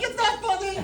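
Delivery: hammed-up annoyed wife; mock scolding wife. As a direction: imitate the wife snapping it's not funny.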